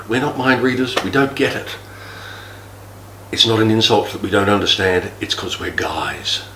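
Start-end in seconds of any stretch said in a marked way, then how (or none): 5.2-6.4 slow whispered aside. Real, not acted. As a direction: let it drop to a whisper. Quiet aside at end of talk.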